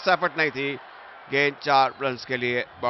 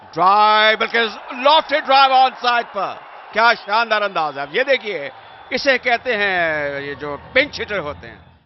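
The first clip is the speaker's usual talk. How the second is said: big rising shout on the shot; excited cricket commentary; 6 semitones higher, 6 dB louder, slower than usual. Real, not acted. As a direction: roar out the lofted drive, higher. Boundary hit, lively commentary. (उत्साहित cricket commentary)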